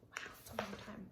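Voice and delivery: hushed quick whisper to listeners; whispered aside. Real, not acted. Reader whispering an aside to the children, hushed. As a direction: breathe the aside softly.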